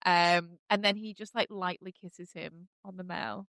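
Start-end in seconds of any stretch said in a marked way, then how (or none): none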